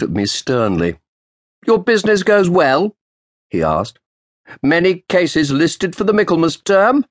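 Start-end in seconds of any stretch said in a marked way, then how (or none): 1.7-2.9 put-on higher, louder sharp old treasurer; old official's sharp greeting. Roland's voice. 4.6-7.1 put-on old official's sharp greeting. Roland's voice.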